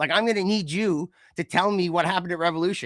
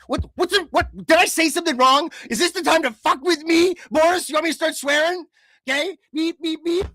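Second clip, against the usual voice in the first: loud, much higher outraged ranting; mock-furious rant; 10 semitones higher, 5 dB louder than usual. Real, not acted. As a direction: blow up at your co-host in fake rage. Ranting in rage at cohost.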